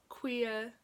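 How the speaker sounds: ordinary talk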